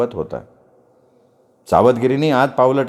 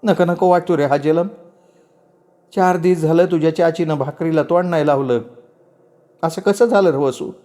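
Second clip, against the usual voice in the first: higher-pitched, weary lament; distressed story character; 5 semitones higher than usual. Acted. Confiding in Vasu about a wretched life, distressed. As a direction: voice a troubled speaker, pitched up.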